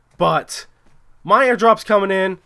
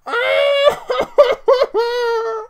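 shrieking laugh. Real, not acted. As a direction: cackle out the frustration. Laughs after rage.

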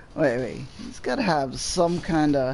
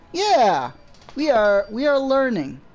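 excited cheer; 8 semitones higher, 7 dB louder than usual. Real, not acted. Celebrating stream working.

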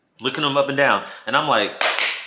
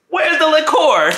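hype call; 12 semitones higher, 5 dB louder than usual. Retold or imitated. Hype man calling for drinks.

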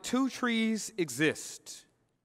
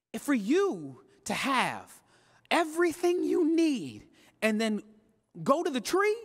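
rising preacher build; 4 semitones higher than usual. Real, not acted.

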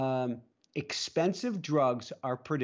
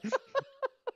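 high laugh. Real, not acted. Cracking up.

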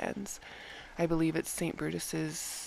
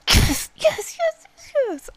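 high squealing yes yes yes, far louder; excited; 11 dB louder, slower than usual. Real, not acted. Gamer squealing over her longed-for prize. Wins the item she wanted.

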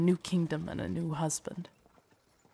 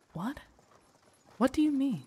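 startled confusion; 7 semitones higher than usual. Acted. Servant startled by queen's revelation.

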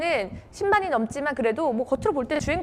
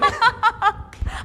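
a burst of real laughter; hearty laugh; 8 semitones higher than usual. Real, not acted. Delighted by the new word.

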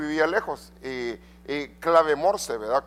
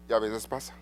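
lower, softer y a veces pasa; quiet aside; 5 semitones lower, 5 dB quieter than usual. Acted. Preacher to congregation, second y a veces pasa dropped low and soft. Preacher repeats phrase, contrasting takes.

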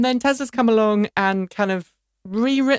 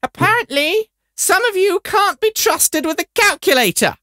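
high, loud, scolding schoolmistress; schoolmarm; 11 semitones higher, 6 dB louder than usual. Acted. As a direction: scold the class like a hammed-up schoolmarm. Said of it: "read it in your best schoolmarm voice".

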